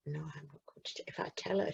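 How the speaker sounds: frightened inner whisper; secret whisper. A narrator's reading. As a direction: hide the thought in a whisper. Boy's fearful secret thought.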